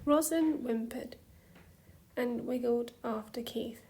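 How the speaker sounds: plain reading voice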